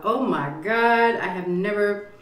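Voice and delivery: ordinary delivery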